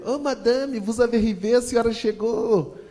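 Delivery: high, slow, sugary angel voice; mock innocent welcome, high and slow; innocent little angel. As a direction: play the sweet little angel at the door. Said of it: "como se fosse um anjinho".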